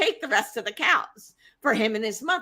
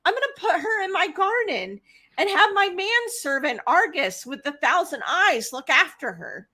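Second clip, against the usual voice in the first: high, sugary mock delight; Hera's high, sugary mock delight to Zeus; fake-sweet Hera; 6 semitones higher than usual. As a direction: play along with venom underneath. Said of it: "she's like".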